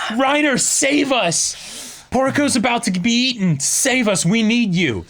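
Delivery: high-pitched mock scream for help; imitated desperate screaming. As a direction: act out a desperate cry without full volume. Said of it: "And she's screaming".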